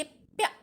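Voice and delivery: प्या said as one crisp syllable, half प flowing into या; modeled syllable. Shown as a demonstration. Teacher models प्या for the children. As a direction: model प्या clearly for the class. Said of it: "तो इस प्रकार हम क्या बोलेंगे?".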